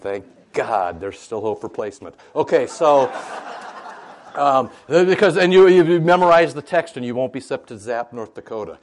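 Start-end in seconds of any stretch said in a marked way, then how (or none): 2.3-8.8 laughing. Real, not acted. Laughing while joking about placement.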